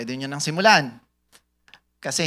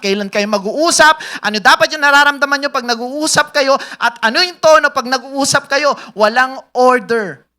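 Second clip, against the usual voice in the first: loud, driving preacher's build; full-force preaching; 7 semitones higher, 10 dB louder than usual. Acted. Pastor preaching to the congregation, loud and driving. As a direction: hammer the point home to the church.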